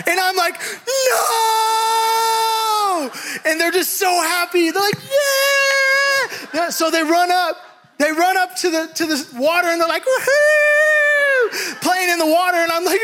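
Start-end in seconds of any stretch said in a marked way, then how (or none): 1.1-3.1 high, panicked cry of no. Retold or imitated. Preacher reliving the broken spigot, panicked high cry. Water gushes, he cries out. 4.9-6.3 put-on high, excited kid cheer. Kids in the street cheering the gushing water, high excited cheer. 10.0-11.8 high, gleeful whoop; kids whooping. Retold or imitated. Kids splashing in the street, high gleeful whoop.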